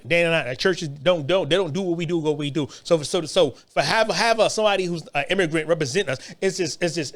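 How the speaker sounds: ordinary talk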